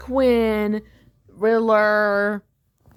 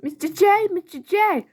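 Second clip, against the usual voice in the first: high, loud calling voice; high-pitched cartoon villainess; Harley Quinn; 11 semitones higher, 4 dB louder than usual. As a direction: call out eagerly, pitched way up.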